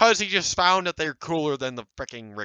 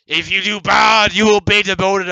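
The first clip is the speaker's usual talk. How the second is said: pompous ruler voice; 7 semitones higher, 13 dB louder than usual. Retold or imitated. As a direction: proclaim it like a pompous emperor. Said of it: "it was like".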